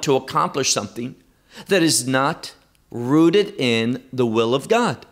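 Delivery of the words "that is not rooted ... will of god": ordinary delivery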